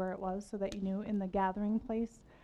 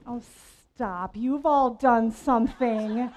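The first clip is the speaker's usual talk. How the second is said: teasing; 8 dB louder, slower than usual. Real, not acted. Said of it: "teasing the audience".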